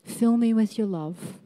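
soft, quiet, earnest prayer; preacher modelling a soft prayer of confession; model prayer. Shown as a demonstration. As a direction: pray it gently as an example for them. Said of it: "Would you like to know how?".

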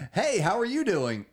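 high, loud fake-cheery greeting; stilted sitcom actor. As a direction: ham up a sitcom hello. Parody of stilted sitcom line.

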